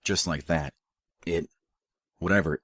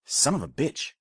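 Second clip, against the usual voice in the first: higher, sudden pained yelp; 9 semitones higher than usual. A narrator's reading. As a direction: jerk it out as the hand stings. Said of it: "he yelped".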